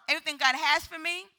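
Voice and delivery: rallying shout. Shown as a demonstration. Preacher leading people to shout their claim, rallying call. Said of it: "Someone shout".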